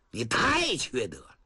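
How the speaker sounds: ordinary delivery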